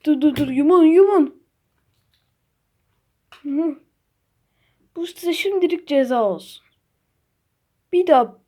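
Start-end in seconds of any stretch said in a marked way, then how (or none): none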